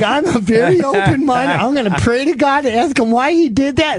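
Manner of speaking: very high, earnest wife voice; wife impression. Born-again wife promising to pray, high earnest voice. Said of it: "She's like".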